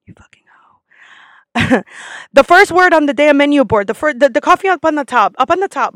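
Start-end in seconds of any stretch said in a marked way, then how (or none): 2.3-6.0 loud, higher-pitched frustrated insistence; exasperated. Acted. Customer pointing out menu item to barista, exasperated. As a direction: point it out loudly, running out of patience.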